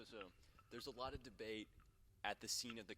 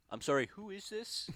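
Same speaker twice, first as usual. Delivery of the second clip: high, loud puzzled phone voice; confused caller voice; 11 semitones higher, 11 dB louder than usual. Retold or imitated. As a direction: play a baffled stranger on the phone. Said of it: "they'd be like".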